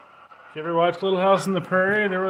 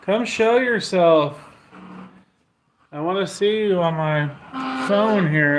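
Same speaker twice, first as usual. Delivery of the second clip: raised-voice summons to a spirit; coaxing call, pitched up; 4 semitones higher, 4 dB louder than usual. Real, not acted. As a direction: call the unseen presence out, loud and clear.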